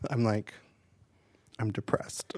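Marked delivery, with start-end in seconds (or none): 1.6-2.3 quiet, soft downbeat admission; deadpan confession. Acted. Friend confessing after promising gossip, quiet deadpan. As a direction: drop it softly, deadpan.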